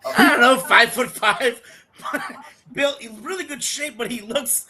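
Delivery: telling it through laughter; high, laughing voice cracking up mid-story. Real, not acted.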